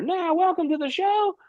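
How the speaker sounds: high, strained mock announcer call; show announcer. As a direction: imitate a big game-show intro from far off. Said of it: "You hear the announcer".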